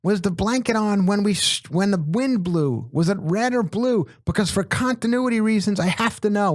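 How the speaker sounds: a director suddenly frantic about continuity; mock shrill panic from the director; panicked director. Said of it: "freaks out at that point and goes".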